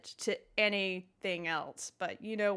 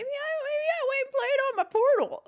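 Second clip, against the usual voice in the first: squeaky voice; 6 dB louder than usual. Pretend. Silly high voice joke.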